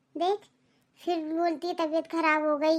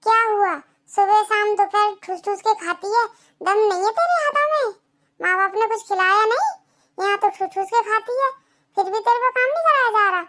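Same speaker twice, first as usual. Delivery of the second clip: taunting scold; 3 semitones higher than usual. Acted. Mother-in-law taunting Lajo.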